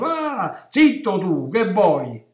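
loud, brusque shushing; dismissive discourager. Acted. Discouraging voices silencing the one who prays, brusque and loud. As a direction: shut them down with impatient scorn. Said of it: "le voci di questi che scoraggiano".

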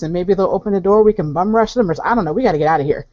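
ordinary talk

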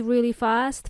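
usual speaking voice

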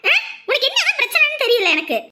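very high, loud, frustrated outburst; angry scolding. Acted. Woman demanding why the child keeps crying, angry scolding. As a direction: yell at the child in sheer frustration.

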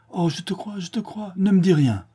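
high, overwhelmed pleading, rising to loud; emotional outburst. A narrator's reading. Sinclair stopping Mathilde's oath, high emotional plea. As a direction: cut her off in a rush of feeling.

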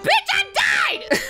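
high, slow indignant shout; high mock yell. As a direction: yell it out in fake outrage. Polly protests her death.

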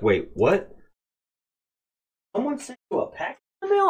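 quick, high, hushed mock wife; imitating wife's disbelief. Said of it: "my wife's like".

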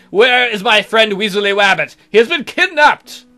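high, loud hammed-up panic; mock alarm. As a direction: cry out as if your friend was kidnapped. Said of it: "suspicious and seek information".

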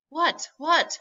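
high, urgent repeated question; crying out. A narrator's reading. Confused boy asking scientists, high urgent cry. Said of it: "I cried".

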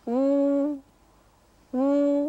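long high "う" through a small lip circle; puckered Mandarin u. Shown as a demonstration. Teacher demonstrating after the tip, puckered held "う". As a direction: push the lips forward and sustain "う". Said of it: "小さな円を作って".

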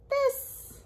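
surprised. Acted. Turns to a surprising page.

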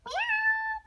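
put-on squeaky kid's cat meow; high mock meow. Frog son shows off his meow in a high squeak. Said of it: "I do a good meow".

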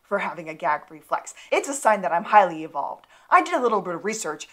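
a hammed-up rant of offended pride; mad rant. Gus ranting at the old guy who mocked his gag reflex, mock angry and loud. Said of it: "Gus is real mad".

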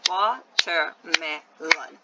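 slow Watermelon in four beats, WA-TER-ME-LON; syllable by syllable. Shown as a demonstration. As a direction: slowly break Watermelon into four even syllables. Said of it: "It has four syllables".

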